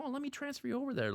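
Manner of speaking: high, fast, sugary phone-agent voice; high-pitched mock customer service chirp; mock sweet agent. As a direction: parody a falsely helpful rep, quick and high. Mocking agent's fake helpfulness.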